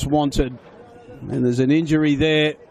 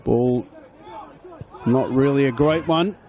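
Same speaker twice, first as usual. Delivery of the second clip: usual speaking voice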